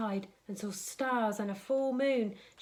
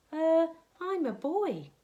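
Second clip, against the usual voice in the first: put-on little boy voice; 7 semitones higher, 3 dB louder than usual. Charlie answers the creature.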